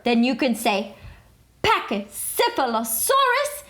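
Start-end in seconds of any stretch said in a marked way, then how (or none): 1.6-3.6 much higher, flourished; high dramatic word. A narrator's reading. Narrator showing off the dino name, high. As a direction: toss the long word up high.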